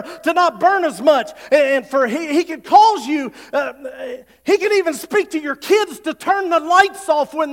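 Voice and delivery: high, loud, impassioned preaching; rally the congregation, building fire; preaching at full force. Acted. Pastor urging the congregation to tithe, high loud preaching.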